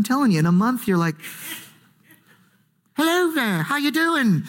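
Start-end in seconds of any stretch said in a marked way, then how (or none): 3.0-4.4 higher, posh-ish mock British greeting; mock British accent. Downton Abbey viewer suddenly sounding British, mock British accent. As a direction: play a Downton Abbey fan gone British. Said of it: "you've got a British accent".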